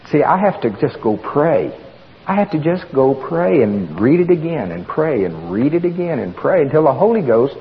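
ordinary talk, nothing marked

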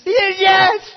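high, full-volume desperate yell; frantic screamed yes. Acted. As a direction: scream it in hysterical eagerness. Terrified man begs to be saved.

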